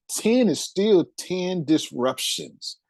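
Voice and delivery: Ten said slowly and hit hard; slow emphatic stress. Real, not acted. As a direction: lean on the first Ten, drawn out. Driving the point home.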